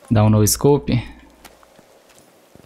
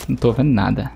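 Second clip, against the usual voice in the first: normal talking voice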